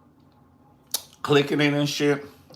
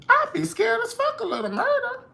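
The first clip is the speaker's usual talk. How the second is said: squeaky high. Real, not acted. Repeats line in squeaky high voice.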